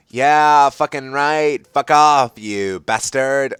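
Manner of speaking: hammed-up angry outburst; loud fake fury; mock scream. A narrator's reading. Ebony cursing at Vampire, loud mock scream. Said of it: "I screamed".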